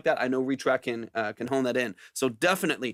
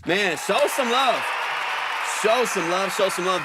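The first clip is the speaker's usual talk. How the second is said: louder, higher, slow hype call; rally the crowd to cheer; hype shout-out; 4 semitones higher, 3 dB louder than usual. Real, not acted. Hyping the producer to chat.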